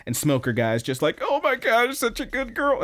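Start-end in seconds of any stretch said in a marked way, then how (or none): 1.2-2.8 high mock-weepy voice; hammed-up moved-to-tears wail; imitated tearful gushing. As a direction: gush as if about to cry, pitched way up. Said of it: "he just starts crying".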